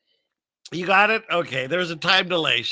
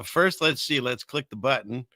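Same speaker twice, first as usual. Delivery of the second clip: ordinary talk, nothing marked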